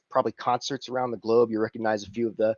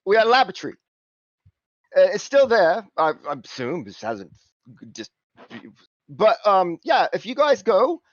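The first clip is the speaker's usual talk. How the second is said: higher, louder cockney patter; cockney fixer; 9 semitones higher, 5 dB louder than usual. Acted. Simon urging the crew to fetch the tree, cockney patter. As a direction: hustle them along, higher and eager. Simon explains the lab job.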